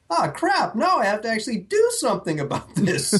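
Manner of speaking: high, laughing "ah crap"; grudging, half-laughing reluctance; reluctant groan. Retold or imitated. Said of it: "moment of, like".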